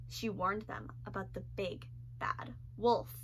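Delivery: whispered warning about the wolf; conspiratorial whisper; hushed warning. A narrator's reading. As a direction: drop to a whisper as if sharing a secret.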